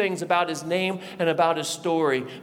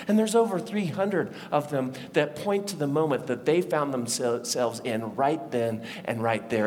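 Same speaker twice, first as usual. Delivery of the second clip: normal talking voice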